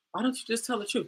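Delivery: soft, calm retold question; calm suggestion. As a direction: quote the friend's advice quietly. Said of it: "very calmly was like".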